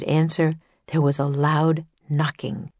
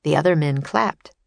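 usual speaking voice

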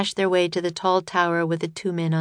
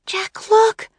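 high, bright little sister calling Jack; girl's voice; 5 dB louder than usual. A narrator's reading. Annie calling to her brother in a much higher voice. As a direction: pitch up high for the young girl.

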